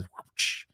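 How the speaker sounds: soft whispered hiss of a swipe; imitated cat swipe. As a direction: breathe out a quick, voiceless whoosh. Imitates cat swiping paw.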